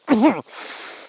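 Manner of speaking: a deliberate test cough; fake cough. Testing ribs after the fall, a fake cough. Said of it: "I start fake coughing".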